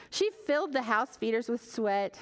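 ordinary delivery

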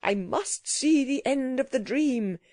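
mildly insistent cry. A narrator's reading. Gentleman dashes up to watch the disaster, crying out. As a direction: call it out with determination.

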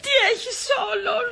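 very high, sustained invalid wail; hammed-up dying whine; feigned heart attack. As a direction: fake being stricken with pains. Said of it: "Έχω ανάγκη από μια καρδιακή κρίση".

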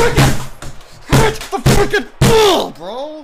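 high, slow, stretched-out scream; furious yell. Real, not acted. Gamer screaming at his teammate to hit the ball, slow furious yell. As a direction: drag the yell out in rage.

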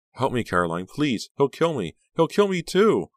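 plea climbing to a high cry; frightened pleading. Acted. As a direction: beg with rising panic. Terrified girl begging friend for help.

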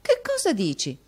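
high, loud, amazed question; astonished mistress. A narrator's reading. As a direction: demand it in theatrical disbelief.

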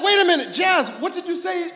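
surprised outburst. Retold or imitated. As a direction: call it out loud and high, stunned by the boy. Preacher retells his own reaction.

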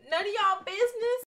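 sassy loud comeback; sassy retort. Real, not acted. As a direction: tell them off with attitude.

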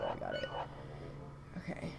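whispered hushed relief. Real, not acted. Streamer tiptoeing past an enemy, whispering. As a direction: breathe it out under your breath. Sneaking past danger in game.

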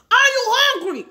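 high, loud mocking squawk; mocking impression. Mocking the guy who ignores his brothers, high loud squawk. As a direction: blurt it out absurdly high and loud. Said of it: "He say".

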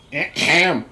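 loud, theatrical "ahem"; mock throat-clearing. Streamer about to read a letter aloud, mock throat-clearing. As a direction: clear the throat loudly for show. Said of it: "my reading voice".

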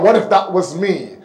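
usual speaking voice

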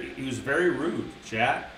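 ordinary delivery

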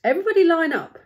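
high, loud storybook order; rallying shout in a read-aloud. Acted. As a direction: call the troops to line up, acted. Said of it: "he shouted".

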